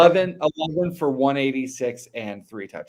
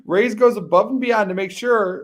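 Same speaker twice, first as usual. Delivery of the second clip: higher, louder commercial pitch; big put-on ad-man delivery; fake announcer voice; 8 semitones higher, 5 dB louder than usual. Co-host plugging a barbershop to listeners, fake announcer voice. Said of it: "read it better with a fake voice".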